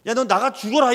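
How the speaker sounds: a father snapping at his defiant son; retold angry shout. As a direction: yell it like an enraged parent. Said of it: "이렇게 얘기했어요".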